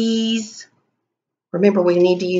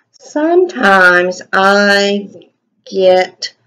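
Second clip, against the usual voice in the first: slow dictation; much slower than usual. Shown as a demonstration. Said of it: "teacher dictating while writing".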